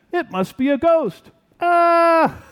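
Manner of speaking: high, loud scared cry ending in a yell; terrified high shout of alarm; frightened shout. Pretend. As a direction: shriek as if a ghost appeared. Disciples imagined seeing a ghost.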